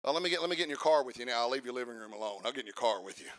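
low, quick, hushed imitation; mock muttered aside. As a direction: run it together under your breath, mimicking. Mimics someone approached at car.

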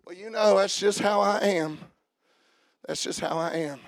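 quiet resigned imitation; resigned mumble. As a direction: mutter it like a tired excuse. Voicing a resigned believer.

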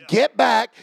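ordinary talk, nothing marked

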